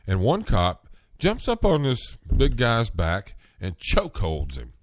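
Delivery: ordinary delivery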